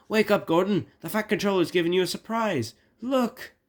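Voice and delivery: high, loud, hurried announcement; excited. Acted. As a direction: burst in with thrilling news. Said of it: "ran to Gordon excited".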